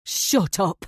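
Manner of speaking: sharp, loud snap of rage; furious man cutting her off; crazed scream. A narrator's reading. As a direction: yell to shut her up. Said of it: "He's screaming like he's crazy".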